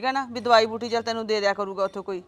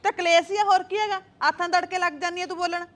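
indignant quarrel; 4 semitones higher than usual. Acted. Quarrel over crying at home.

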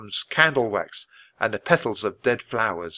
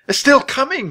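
very high, loud cry of panic; panicked shriek; 7 dB louder than usual. A narrator's reading. Jack warning his friends as the bone follows, panicked shriek. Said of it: "he shrieked".

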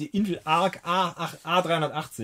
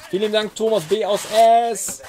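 loud, high thank-you shout; raised, punchy announcer-style thanks; 5 semitones higher, 6 dB louder than usual. Real, not acted. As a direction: announce the supporter at full voice.

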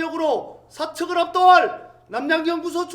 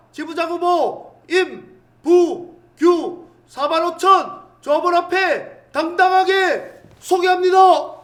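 announcer build-up; 5 dB louder than usual. Acted. Announcing the candidate.

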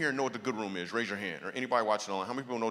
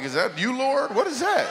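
high, loud, laughing mock astonishment; hammed-up startled cry to the Lord; mock surprise; 12 semitones higher, 8 dB louder than usual. Pastor hearing the band's music, mock astonished. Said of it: "I'm like".

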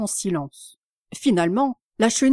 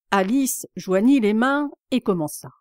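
plain reading voice

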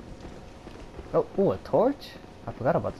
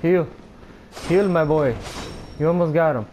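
ordinary talk

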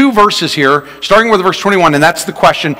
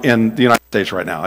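normal talking voice

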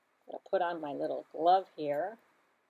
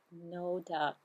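ordinary delivery

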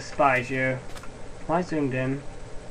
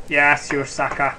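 ordinary delivery

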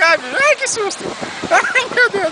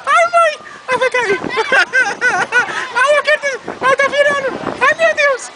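high, frightened whimpering pleas; begging to get off, frightened; scared; 5 semitones higher than usual. Real, not acted. Scared on a fair ride.

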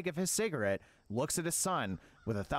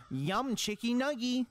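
slow, higher "Yum, chicky nuggie." sung sweetly; cutesy demo; 6 semitones higher, slower than usual. Shown as a demonstration. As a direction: show the kids how to coo "Yum, chicky nuggie.".

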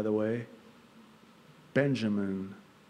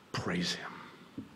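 whispered praise; 2 semitones lower, slower than usual. Real, not acted. Preacher whispering praise to God mid-sermon. Hushed worship aside.

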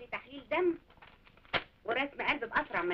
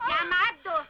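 loud, raised call for help; urgent, higher holler; 4 semitones higher, 7 dB louder, slower than usual. Acted. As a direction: shout to summon someone over. Calling someone over to help.

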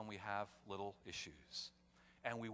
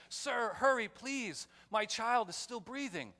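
higher, louder frantic plea to drive faster; desperate father pleading; 10 semitones higher, 6 dB louder, slower than usual. Retold or imitated. Father in back seat begging reporter to hurry, raised pleading voice. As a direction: beg him to hurry, voice raised and strained.

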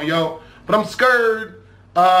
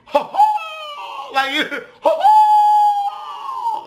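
goofy high cackle; much slower than usual. Pretend. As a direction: shriek a silly laugh way up high. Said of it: "Like this".

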